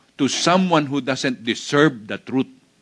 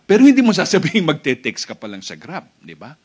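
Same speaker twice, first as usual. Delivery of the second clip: ordinary talk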